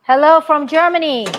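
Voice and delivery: normal talking voice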